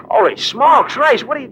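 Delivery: gasp, then high loud shout; stunned outcry at the accusation; shocked exclamation. Acted. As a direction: blurt it out in astonishment.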